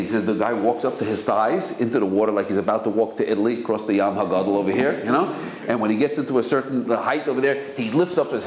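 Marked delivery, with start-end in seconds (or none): none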